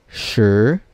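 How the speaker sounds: Shi as sh with a dark short vowel, rising; second tone. Shown as a demonstration. Teacher reading number ten, Shi in rising second tone. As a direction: slide Shi upward, no ee. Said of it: "and then second tone".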